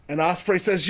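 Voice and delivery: usual speaking voice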